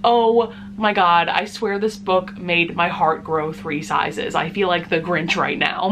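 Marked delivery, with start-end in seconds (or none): none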